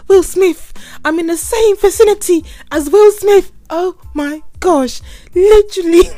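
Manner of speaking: high, loud excited gasping over a celebrity; starstruck squeal. Retold or imitated. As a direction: squeal like meeting your idol. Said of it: "it would be like".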